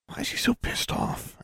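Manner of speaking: whispered, puzzled thought; soft, voiceless inner question; wondering to herself. A narrator's reading. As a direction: wonder it under your breath. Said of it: "Alexandra wondered".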